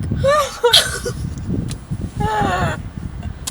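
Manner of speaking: high, drawn-out helpless laugh. Real, not acted. Telling friend about the trash insult, laughing. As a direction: break into high laughter mid-story.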